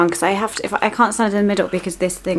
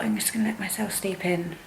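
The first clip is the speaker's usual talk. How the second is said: whisper; 9 dB quieter than usual. Retold or imitated. Retelling her sleepy morning thought.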